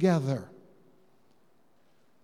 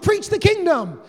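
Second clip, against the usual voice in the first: rallying shout; 9 semitones higher, 5 dB louder than usual. Retold or imitated. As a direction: shout it like an eager cheer. Disciples' eager rallying cry.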